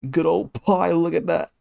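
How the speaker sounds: hammed-up British accent; mock British accent. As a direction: play the chuffed British bloke admiring his pie. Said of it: "I have a good British accent".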